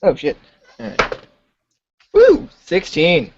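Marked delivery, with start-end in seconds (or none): 2.1-2.5 high, loud "woo"; short real cheer, pitched way up; excited whoop. Real, not acted. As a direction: whoop at a lucky roll. Player cheers at a dice roll.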